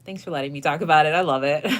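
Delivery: bursting, loud happy thanks; loud delighted gushing; excited. Real, not acted. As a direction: gush your thanks, loud and quick.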